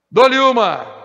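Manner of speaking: loud auctioneer call. Real, not acted. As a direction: ring out the going-twice call. Auctioneer's going-once call. (chamada alta de leiloeiro)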